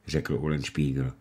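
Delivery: usual speaking voice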